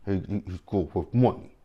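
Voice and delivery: stuttered, clenched-jaw imitation of the accent; mocked Kanan accent. Annoyed viewer mimicking Kanan's line, clenched-jaw accent. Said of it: "him and this clenched jaw".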